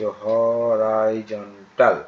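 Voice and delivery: হরাইজন্টাল stretched slowly as ho-rai-jon-tal; teacher sounding out হরাইজন্টাল piece by piece; syllable by syllable. Shown as a demonstration. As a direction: break হরাইজন্টাল into long, separate syllables. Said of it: "এইভাবে উচ্চারণ করবে".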